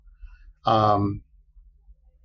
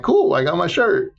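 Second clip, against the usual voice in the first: retold happy cheer, much higher; 11 semitones higher than usual. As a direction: chirp it like a kid who got his toy. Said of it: "I was all happy".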